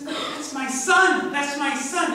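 joyful shout. Acted. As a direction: call out in overjoyed recognition. Father spots son far away.

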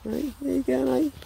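high, soft coo to a puppy; puppy talk. Real, not acted. Talking softly to a puppy.

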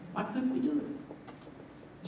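slow, near-whispered question; hushed bafflement. Retold or imitated. Disciples privately ask Jesus about their failure, hushed bafflement.

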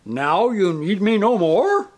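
slow, high, loud melodramatic question; hammed-up tragedian's wail; hackneyed despair. A narrator's reading. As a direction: draw it out in stagey anguish. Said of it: "with a hackneyed gesture of despair".

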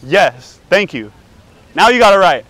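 loud, beaming approval; loud triumphant approval. Pretend. Prankster loudly praising the man for getting it right, triumphant. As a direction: cheer him for finally getting it right.

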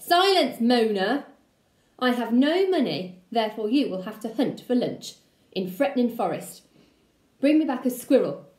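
loud, barking wolf uncle; bossy uncle shout. Acted. Gruff uncle bossing his nephew, loud. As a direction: order him about at full volume. Uncle voiced ordering Little around.

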